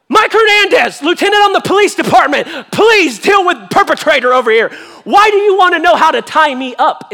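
loud, high shout for help; alarmed call-out. Acted. Pastor calling a police lieutenant over, mock-alarmed shout. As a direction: holler urgently across the room.

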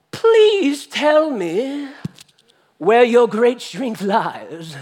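drawn-out, high coaxing plea; coaxing seductress. Acted. Delilah coaxing Samson for his secret, hammed-up slow plea. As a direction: wheedle it slowly, hammed up.

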